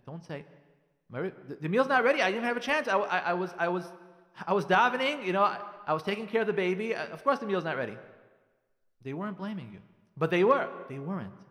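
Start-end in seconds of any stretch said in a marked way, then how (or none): 1.1-8.0 flustered, louder mock self-defence; defensive. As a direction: stammer out excuses, loud and indignant. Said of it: "Don't defend yourself".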